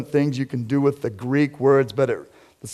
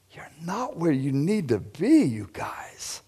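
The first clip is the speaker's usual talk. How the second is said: fading to a whisper; 4 semitones higher, 4 dB quieter, slower than usual. Acted. Jesus voiced, rebuke fading.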